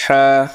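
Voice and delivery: হা with air pushed from inside, held long; breathy deep ha. Shown as a demonstration. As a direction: let breath rush through হা. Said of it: "বাতাস বের হবে". (ভিতর থেকে বাতাস ছেড়ে হা)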